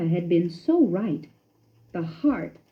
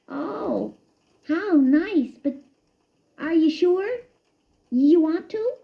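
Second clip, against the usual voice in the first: high, halting guilty mouse; a guilty mouse stalling awkwardly; mild nervous fumbling; floundering; 10 semitones higher, slower than usual. A narrator's reading. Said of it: "floundered Christopher".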